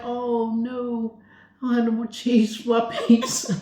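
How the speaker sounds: laughing mock lament; mock dismay. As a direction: play up the cheese disaster with a laugh. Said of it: "And I was like".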